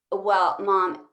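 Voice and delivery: ordinary talk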